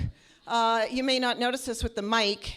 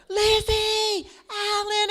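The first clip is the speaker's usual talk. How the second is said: long drawn-out high shout across a crowd; shouting over a parade; yelling a name; 9 semitones higher, much slower than usual. Retold or imitated.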